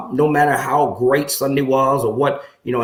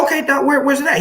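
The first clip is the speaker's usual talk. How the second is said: eager surprise; 12 semitones higher, faster than usual. Retold or imitated. Retold eager reply to professor.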